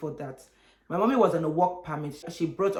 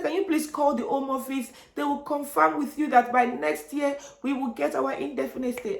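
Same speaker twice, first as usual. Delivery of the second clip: high, tearful pleading; acted tearful plea; imitated crying; 7 semitones higher than usual. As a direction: beg through put-on tears. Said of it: "I started crying".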